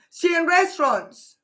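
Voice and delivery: ordinary talk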